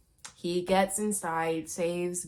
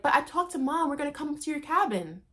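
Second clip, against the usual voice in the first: put-on girly daughter; 7 semitones higher, faster than usual. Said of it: "the daughter's like".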